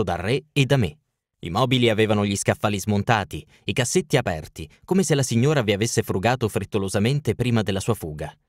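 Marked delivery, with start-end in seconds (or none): none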